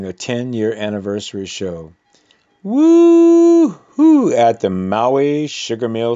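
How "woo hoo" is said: high, loud cheering whoop; shrieking party cheer; excited whoop. Real, not acted. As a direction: let out a big celebratory woo-hoo. Host cheers for anniversary show.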